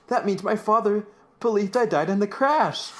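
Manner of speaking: high, mock dramatic discovery; hammed-up realization. Eugene realizing his father's belief, mock dramatic. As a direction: play a startled revelation, a touch hammy.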